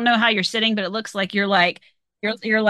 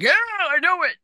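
imitating a friend; 9 semitones higher than usual. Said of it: "he's like".

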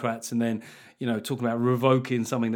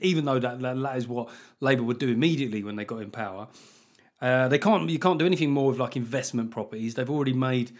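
ordinary talk, nothing marked

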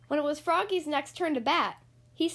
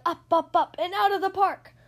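excited; 5 semitones higher, 5 dB louder than usual. A narrator's reading. Ball flying, excited narration.

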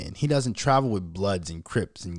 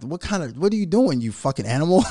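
raised, louder mock scolding breaking into laughter; amused scolding; 6 semitones higher, 4 dB louder than usual. Father scolding his caught son, laughing mock outrage. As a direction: scold him while starting to crack up.

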